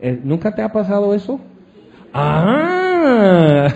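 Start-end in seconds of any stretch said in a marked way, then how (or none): 2.1-3.7 a loud, hammed-up ah of dismay; mock cry of dismay. Preacher mocks being swindled with a loud comic ah. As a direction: groan out a comic ah.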